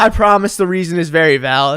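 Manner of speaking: ordinary talk, nothing marked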